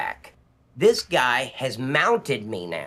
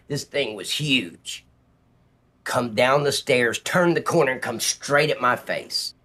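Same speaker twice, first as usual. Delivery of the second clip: usual speaking voice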